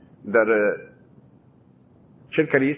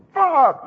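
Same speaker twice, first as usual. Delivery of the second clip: slap sound; 11 semitones higher, 5 dB louder than usual. Retold or imitated. Imitating the slap.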